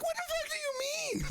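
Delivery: very high, wailing protest; hammed-up tearful outrage; mock bawling. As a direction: shriek it through mock tears. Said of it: "Like, I was bawling".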